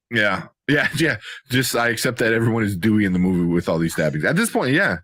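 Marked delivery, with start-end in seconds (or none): none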